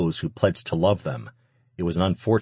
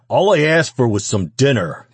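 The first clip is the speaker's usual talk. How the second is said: loud, raised-pitch rant; angry shouting; 6 semitones higher, 6 dB louder than usual. A narrator's reading. Man complaining to the woman about dinner, angry shouting. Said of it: "the man continued shouting".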